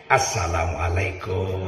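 low, slow, soft salam; gentle kiai greeting. Retold or imitated. Gentle kiai greeting gamblers outside the mosque, low and slow. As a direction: greet them kindly like an old kiai. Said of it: "yang datang itu kiai".

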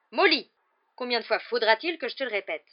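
loud, high burst of impatience; exasperated. A narrator's reading. Husband banging the table at his wife, exasperated. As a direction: lose patience with your wife.

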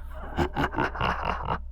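hissing, whispered evil cackle; shrill ghostly cackle. Acted. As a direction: cackle like a spirit. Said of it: "cười sằng sặc mà rít lên".

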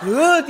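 loud mocking imitation of his noise; imitated car roar. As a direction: yell the noise back accusingly. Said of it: "diye bağırdın". (bağırarak ses taklidi)

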